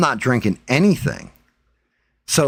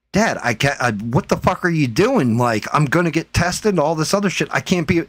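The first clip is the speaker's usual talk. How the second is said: higher-pitched, exasperated plea; retold protest; 6 semitones higher than usual. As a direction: act out a son objecting to his dad. Retold protest of son to father.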